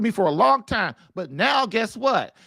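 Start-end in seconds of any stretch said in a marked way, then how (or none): none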